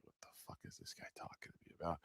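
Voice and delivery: whispered puzzled aside; her thought. Retold or imitated. Cashier silently wondering about him, whispered. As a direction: breathe it as a baffled inner thought. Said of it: "she was like".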